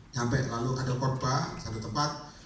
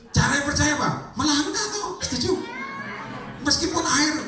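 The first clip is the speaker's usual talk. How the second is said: high impassioned pulpit call; fiery preaching; 14 semitones higher than usual. Acted. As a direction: rouse the congregation to answer. Preaching at full force.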